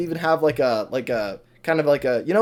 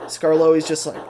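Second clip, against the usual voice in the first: ordinary talk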